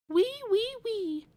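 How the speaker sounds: high squeaky piglet voice; little pig squeal. Acted. Piglet squeals his little song.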